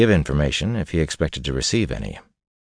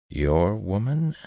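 slow, higher-pitched wary question; careful; 5 semitones higher, much slower than usual. A narrator's reading. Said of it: "Hanlon said carefully".

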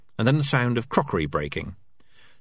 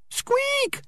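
tiny squeal, very high; screaming mouse. Acted. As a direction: shriek it like a trapped mouse. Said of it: "screamed the mouse".

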